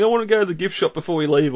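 high, whining child imitation; whiny kid voice. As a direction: whine like a bratty kid. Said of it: "the annoying child saying".

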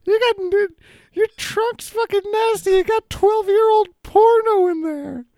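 squeaky high character voice; high put-on voice. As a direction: pitch it way up and whiny. Charles character voice, held.

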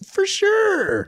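very high, mock-cheerful squeal; feigned enthusiasm. Son humouring his mom's request, falsely thrilled. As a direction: overdo the eager agreement, high-pitched. Sarcastic eager reply to mom.

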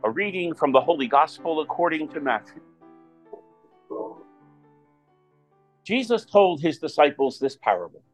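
solemn proclaimed scripture reading; Gospel reading. Acted. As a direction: read it out like a lector at Mass.

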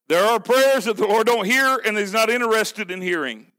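usual speaking voice

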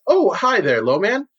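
high, eager host voice, pitched well up; smooth guild boss Moranis. Acted. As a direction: play the slick host showing off a new client. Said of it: "and says".

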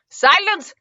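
high, imperious order; commanding bulldog. A narrator's reading. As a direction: silence the crowd like the alpha.

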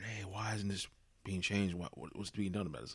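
ordinary talk, nothing marked

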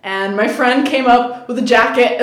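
ordinary talk

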